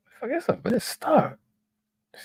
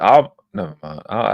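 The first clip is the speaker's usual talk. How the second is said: usual speaking voice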